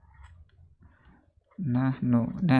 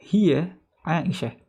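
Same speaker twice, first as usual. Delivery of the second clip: Hiya Aisyah drawn out slowly, stress on Hiya; slow confirmed answer; 4 semitones higher, 4 dB louder, much slower than usual. Shown as a demonstration. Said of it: "Iya, benar".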